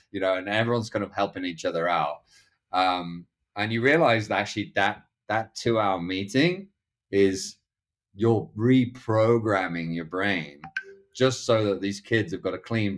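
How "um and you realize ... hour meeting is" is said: ordinary talk, nothing marked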